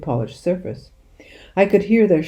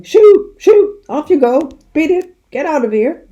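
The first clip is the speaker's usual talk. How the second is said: very high, very loud shooing shouts; shouting; 11 semitones higher, 10 dB louder than usual. A narrator's reading. Sergeant shooing pheasants off the forecourt, very loud shouts. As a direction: chase the birds off at full voice.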